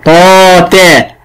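طا تا said slowly, طا heavy and emphatic, تا light; slow ta taa contrast. Shown as a demonstration. Teacher drilling طا تا slowly with the pupil, emphatic then light. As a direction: draw out طا تا, emphatic then thin. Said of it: "الفرق بين حرف الطاء أنه مفخم".

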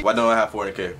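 ordinary delivery

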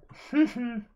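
light, high little laugh at yourself; chuckle. Real, not acted. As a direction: let out a quick real laugh. Chuckles at own mistake.

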